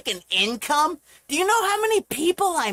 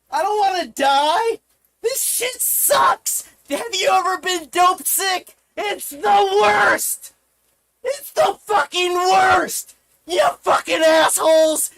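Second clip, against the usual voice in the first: anguished shouting; 4 semitones higher, 3 dB louder than usual. Real, not acted. Dope-sick streamer crying out to viewers, anguished yelling. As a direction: howl the misery out, stretching the key words.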